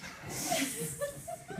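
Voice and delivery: high squealing laugh; high cackle. Real, not acted. Laughs at own joke.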